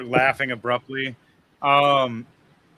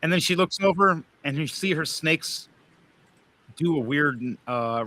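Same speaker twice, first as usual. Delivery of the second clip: usual speaking voice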